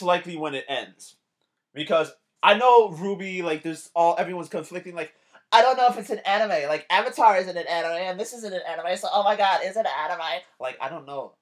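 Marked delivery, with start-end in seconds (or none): 5.5-10.4 whiny, higher mock-fan voice, rattled off fast; mocking anime fans. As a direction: parody a confused fan who can't decide if it's anime. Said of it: "why do you fuckers talk like that".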